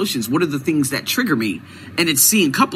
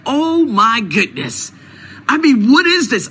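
retold shock; 8 semitones higher, 3 dB louder than usual. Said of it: "I said".

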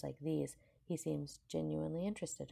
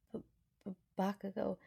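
higher, hesitant stammer of a name; nervous stammer; 5 semitones higher than usual. A narrator's reading. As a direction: stumble nervously over the first sound. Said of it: "she whispers".

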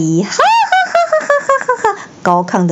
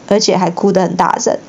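plain reading voice

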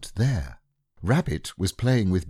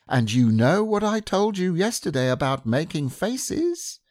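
higher, firm motherly scolding; 6 semitones higher than usual. Acted. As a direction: warn a naughty child. Kanga scolds.

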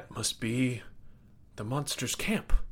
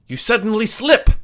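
dramatic exclaim; 11 semitones higher, 12 dB louder than usual. A narrator's reading. Sudden dramatic slip in story.

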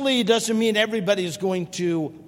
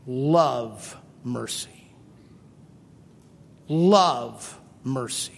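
solemn command; 10 semitones lower, much slower than usual. Real, not acted. God telling the church to love mercy, slow and low. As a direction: let it sink in slowly.

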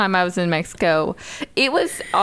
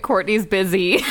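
ordinary talk

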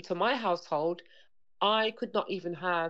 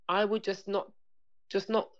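ordinary talk, nothing marked

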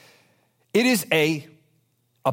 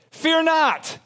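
sudden high exclaim; 10 semitones higher than usual. Acted. Reading the humorous worm verse.

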